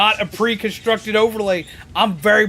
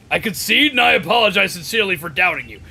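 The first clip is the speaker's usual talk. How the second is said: ordinary delivery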